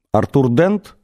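name asked with a rise on "Дент"; checking question. A narrator's reading. Alien checking who Arthur is, asking "Артур Дент?" with a rise. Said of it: "переспросило оно".

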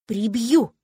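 loud, forceful threat; threatening. A narrator's reading. Heroine fending off a kiss, threatening. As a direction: burst out with a warning. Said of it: "сунув ему под нос кулак".

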